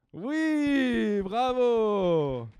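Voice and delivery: excited. Real, not acted. Child guesses right, praised.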